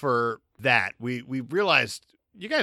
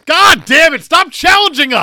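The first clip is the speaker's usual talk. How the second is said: loud exasperated shout, pitched way up; mock angry yell; 13 semitones higher, 12 dB louder than usual. Host yelling at the guest to stop challenging them. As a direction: yell it like you've finally had enough.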